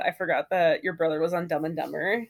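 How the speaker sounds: normal talking voice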